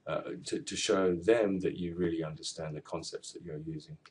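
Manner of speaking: ordinary talk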